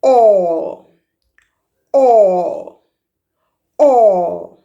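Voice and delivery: "O" held long with rounded lips; open O sound. Shown as a demonstration. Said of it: "Another sound of the letter A".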